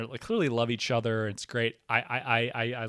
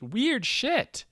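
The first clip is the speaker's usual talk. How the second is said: emphatic; 12 semitones higher, 5 dB louder than usual. Real, not acted. Repeats it, then whispers it.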